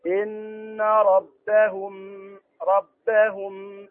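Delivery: very slow recitation, "ربهم" ending in long hum; recitation showing ikhfa. Shown as a demonstration. Teacher correcting a student's reading, drawn-out recitation. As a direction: hold the meem of "ربهم" in a nasal hum. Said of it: "لابد أن تظهر الإخفاء هنا".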